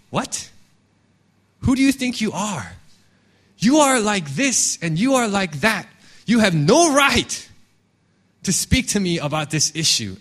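acted indignant retort, high on the challenge; riled-up defensive. Accused person rebuking whoever points something out, mock indignant defensiveness. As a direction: throw the accusation back, leaning on each charge. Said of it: "And we get so riled up, and we get defensive".

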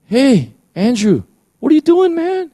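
a playful voice rising to a squeak; very high teasing call; Holy Spirit calling by name. Pretend. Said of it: "you hear the Holy Spirit say".

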